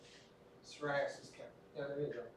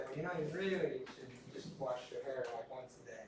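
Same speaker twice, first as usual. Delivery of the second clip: softer, patient voice advising a patient; a clinician gently correcting a patient's habit, softer; gentle advice; 4 semitones lower, 7 dB quieter than usual. Shown as a demonstration. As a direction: ease them toward washing once a day. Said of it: "You say".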